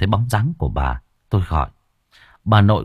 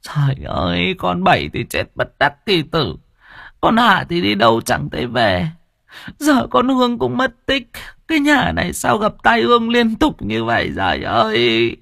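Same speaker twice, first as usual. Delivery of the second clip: a higher, tearful lament; choked with tears; 9 semitones higher than usual. Acted. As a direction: wail it like a grieving grandmother. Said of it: "giọng nghẹn ngào".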